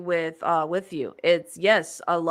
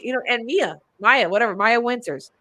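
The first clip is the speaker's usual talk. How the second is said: ordinary talk, nothing marked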